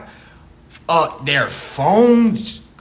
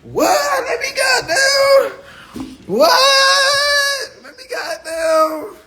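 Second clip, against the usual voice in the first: high, loud whooping yell; mock wailing shout, high and stretched out; hyped guys hollering; 4 dB louder, much slower than usual. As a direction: holler like the boys just heard gossip. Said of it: "we like".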